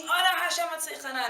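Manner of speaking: high, fervent shouted plea; everyone screaming. Retold or imitated. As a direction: belt it out like the whole shul at once. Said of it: "and everyone's screaming".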